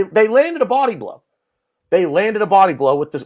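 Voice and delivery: usual speaking voice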